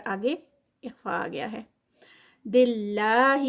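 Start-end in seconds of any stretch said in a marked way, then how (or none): 2.4-3.4 slow drawn-out recited word; Quran recitation demo. Shown as a demonstration. Teacher showing a student how to recite دلہ. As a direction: recite it slowly, drawing it out. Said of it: "اللہ کا نام جہاں پہ بھی آتا ہے".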